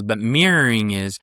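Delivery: normal talking voice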